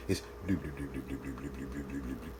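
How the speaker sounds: soft, low bubbling bloop sounds; quiet, low-pitched imitation of a pot simmering softly; gentle simmer bloops. Shown as a demonstration. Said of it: "That's the simmer sound".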